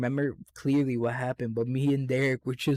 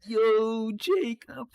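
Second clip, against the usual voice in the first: calling out; 9 semitones higher, much slower than usual. Retold or imitated. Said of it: "everybody's like".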